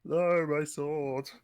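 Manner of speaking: drawn-out, high, loud silly cry; stupid voice. Acted. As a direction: wail it slowly in a stupid voice. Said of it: "in a stupid voice".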